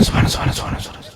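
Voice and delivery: whispered rapid-fire rattle, slurred together; rushed rote dhikr. Retold or imitated. Preacher mocking those who say a hundred a minute, gabbled whisper. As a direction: race through it a hundred times a minute. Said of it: "এক মিনিটে বলে একশো বার".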